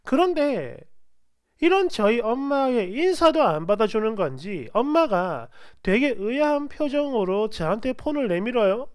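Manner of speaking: ordinary delivery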